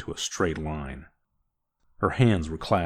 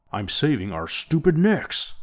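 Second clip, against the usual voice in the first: higher, louder bark; 10 semitones higher, 4 dB louder than usual. Acted. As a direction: snap back at him hard. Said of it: "barked Orn".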